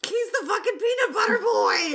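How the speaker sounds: high laughing yell; laughing shout. Real, not acted. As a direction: shout it while cracking up.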